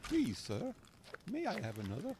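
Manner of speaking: high, pleading English orphan voice; swooping, hammed-up English accent; Oliver Twist. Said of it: "except for Oliver Twist".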